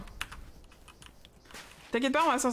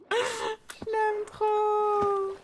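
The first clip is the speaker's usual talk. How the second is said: gushing; 9 semitones higher, much slower than usual. Real, not acted. Gushing over her pet.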